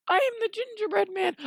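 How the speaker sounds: high-pitched little gingerbread man. Acted. Gingerbread man introduces himself, high-pitched voice. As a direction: squeak it out in a high put-on voice. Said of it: "it's a gingerbread man".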